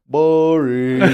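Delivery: drawn-out mock jeer; mock heckling. Listeners mocking the hosts' serious talk, mock heckling.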